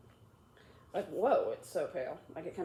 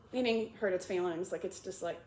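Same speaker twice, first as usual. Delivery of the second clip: plain reading voice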